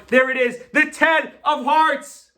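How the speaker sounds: high, loud showman's announcement; triumphant reveal. Real, not acted. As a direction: proclaim the reveal to the whole crowd. Magician's big reveal to audience.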